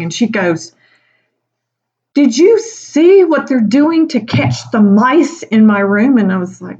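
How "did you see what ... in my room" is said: high, slow outraged voice; hammed-up appalled coworker; mock indignant question, higher and slower; upset colleague.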